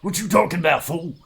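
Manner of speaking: high, loud tough-guy impression; A-Team tough guy. Player riffs on the A-Team haircut, tough-guy impression. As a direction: challenge them like an action-show hard man. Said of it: "The A-Team special?".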